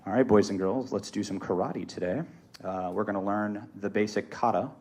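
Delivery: low, soft, listless class greeting; low energy. Shown as a demonstration. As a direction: demonstrate a dull teacher with no energy. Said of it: "It's gonna be low".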